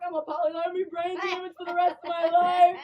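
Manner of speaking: high, loud wail of doom; hammed-up distraught voice; mock hysterical apology. Retold panicked apology.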